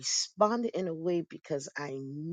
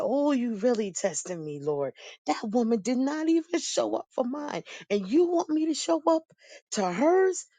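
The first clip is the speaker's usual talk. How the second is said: higher and louder, climbing in outrage; exasperated disbelief; 8 semitones higher, 5 dB louder than usual. Retold or imitated. Telling God about her mother, rising outraged disbelief. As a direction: protest to the Lord, rising higher with each line. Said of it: "I said".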